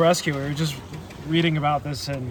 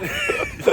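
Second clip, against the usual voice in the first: big shrieking laugh; loud burst of laughter; 4 dB louder than usual. Real, not acted. Joking about slow rescue.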